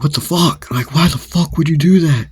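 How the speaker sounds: retold high-pitched outburst; shocked outrage. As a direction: protest in acted disbelief. Acted outrage after being hit.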